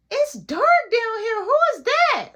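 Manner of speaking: put-on high, slow, loud girl's call into the dark; high girly voice. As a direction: mimic a sister squealing who's there. Kayla quoted walking in.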